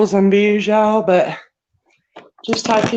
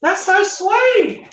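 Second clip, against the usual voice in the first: touched; 10 semitones higher, slower than usual. Real, not acted. Touched by viewer comment.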